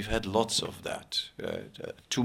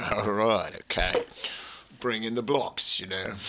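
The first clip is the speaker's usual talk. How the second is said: put-on Australian accent. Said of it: "that's Australia, mate".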